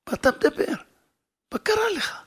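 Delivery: incredulous exclaim. Retold or imitated. Wife exclaiming to her husband in disbelief. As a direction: burst out in disbelief.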